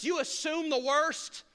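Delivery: ordinary talk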